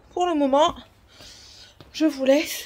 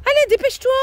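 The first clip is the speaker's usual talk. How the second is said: high, loud call to hurry; 9 dB louder, faster than usual. Real, not acted. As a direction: shout it across the yard. Urging child to hurry.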